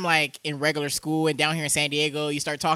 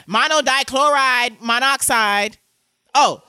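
slow, higher, loud mock drawl; 5 semitones higher, 5 dB louder, slower than usual. Host mimicking a smart girl who drags her words, loud slow drawl. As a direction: stretch each syllable, pitched up and loud. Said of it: "she drags her words".

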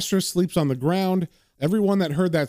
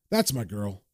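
soft coaxing; 8 semitones lower, 5 dB quieter than usual. Acted. John manipulating, soft.

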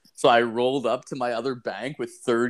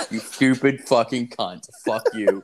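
ordinary talk, nothing marked

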